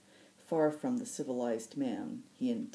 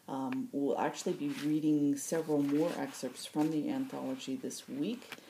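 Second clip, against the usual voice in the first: ordinary delivery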